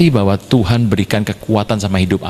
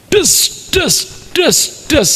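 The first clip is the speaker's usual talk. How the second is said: short, spaced, high impact noises; imitated thudding blows. Preacher mimics striking the tree, spaced sound effect. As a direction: mimic each strike landing.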